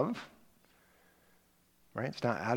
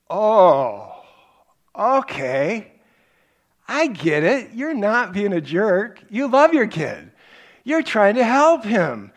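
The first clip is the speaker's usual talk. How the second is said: sudden realization; 10 semitones higher, 11 dB louder, slower than usual. Retold or imitated. Retold realization, answering the pastor.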